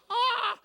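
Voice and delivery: sharp high yelp of alarm; a sudden loud high cry; shocked yelp. Retold or imitated. Startled son cries out at the deathbed. His shocked outburst retold.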